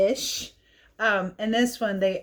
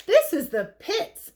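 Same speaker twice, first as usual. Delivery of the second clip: high, complaining mummy voice; whiny mummy; 11 semitones higher, 4 dB louder than usual. Acted. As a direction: squeak it out like a fed-up little mummy. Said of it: "said a mummy one day".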